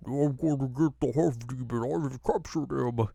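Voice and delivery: thick, muddy put-on giant voice; Andre the Giant. Tree boasts of capturing half demon, giant impersonation. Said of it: "tree sounds just like Andre the Giant".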